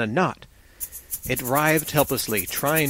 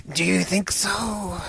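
slow, higher, sibilant snake voice; smiling serpent man; 5 semitones higher, slower than usual. A narrator's reading. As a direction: draw it out slyly with a smile, hissing the s. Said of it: "smiled the other".

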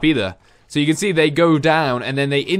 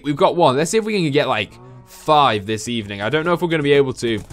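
ordinary talk

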